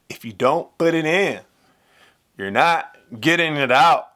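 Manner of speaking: spoken over mic taps; line said while thumping the mic; tapping the mic. Shown as a demonstration. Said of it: "I'm currently tapping the mic".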